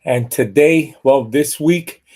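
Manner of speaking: loud announcer welcome to the show; booming game-show host greeting; show host intro. Acted. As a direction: boom it out like a TV host opening.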